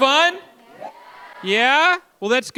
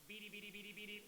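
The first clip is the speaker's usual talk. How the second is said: Martian noise; 37 dB quieter, faster than usual. Shown as a demonstration. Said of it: "when I say Martian".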